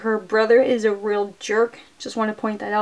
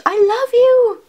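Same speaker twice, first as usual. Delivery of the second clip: high, loud mock cry of love; hammed-up declaration; 12 semitones higher, 7 dB louder, slower than usual. Recapping nurse's funny English line to her sons, hammed-up. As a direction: call it out theatrically, far above normal pitch.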